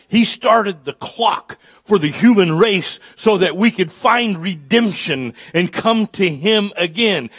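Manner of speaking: loud, high, building sermon climax; rising revival preacher fire; full-force preaching. Acted. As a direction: preach it out to the back row.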